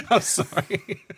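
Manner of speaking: high, slow apology through real laughter; laughing apology. Real, not acted. Host apologizing after cracking up co-hosts, laughing. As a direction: break up laughing while apologizing.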